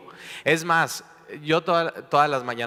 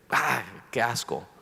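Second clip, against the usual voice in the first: disgusted; 2 semitones lower, 4 dB quieter than usual. Acted. Tasting lukewarm coffee in disgust.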